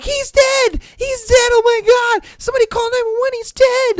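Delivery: very high, loud mock-panicked screaming; panicked onlookers. Onlookers crying for help by the pool, panicked. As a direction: shriek it like a hysterical crowd, hammed up.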